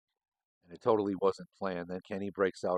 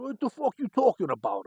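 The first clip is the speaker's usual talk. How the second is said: much higher, louder indignant put-on voice; indignant Danny; 13 semitones higher, 5 dB louder than usual. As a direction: bark back, offended and baffled. Danny angrily retorting.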